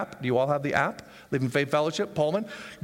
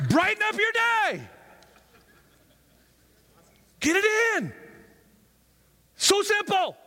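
excited pitch; 14 semitones higher than usual. Acted.

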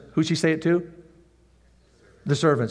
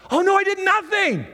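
high, whiny lazy servant; mocking the lazy servant in a high voice; 3 dB louder than usual. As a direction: squeak it out like a smug slacker.

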